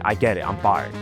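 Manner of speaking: low, falling statement; resigned acceptance. Acted. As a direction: concede it, letting "fired" drop. Grudgingly accepts being sacked.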